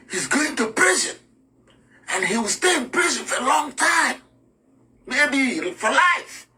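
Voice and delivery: scare her with the prison sentence; threatening officer. Acted.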